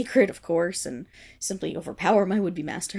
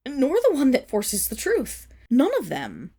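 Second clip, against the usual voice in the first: louder, higher forbidding; firm outraged ban; indignant command; 5 semitones higher, 5 dB louder than usual. Acted. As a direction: lay down the law sharply. Forbidding the stones.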